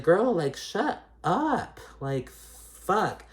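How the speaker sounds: put-on high and slow, drawn-out exasperated girl shut up; mock telling off. Addressing the cast member, a slow exasperated mock shut-up. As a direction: stretch it out like you're done with her.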